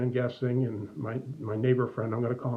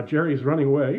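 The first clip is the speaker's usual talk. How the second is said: usual speaking voice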